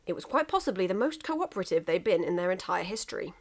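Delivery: plain reading voice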